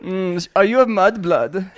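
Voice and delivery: mock-German interrogator; German accent. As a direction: question in a put-on German accent. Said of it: "You still gotta do the German".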